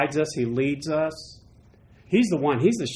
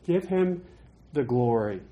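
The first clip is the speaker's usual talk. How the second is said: normal talking voice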